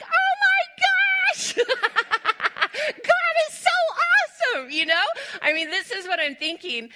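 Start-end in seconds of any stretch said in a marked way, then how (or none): 0.0-4.7 high, loud squeal of amazement; tearful awe. Retold or imitated. Mother reacting to the view of the kitchen, tearful awe. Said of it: "I'm crying already. I'm like".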